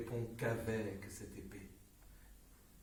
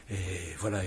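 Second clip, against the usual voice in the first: usual speaking voice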